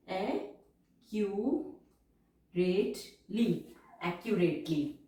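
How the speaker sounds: each word stretched out and repeated for writing down; measured shorthand dictation, words drawn out; slow dictation. Shown as a demonstration. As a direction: dictate slowly so students can keep up. Teacher dictating passage word by word.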